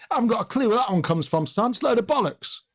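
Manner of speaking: imitating an indignant manager, pitched high; dismissive denial. Manager denying his own planted story to local radio, dismissive.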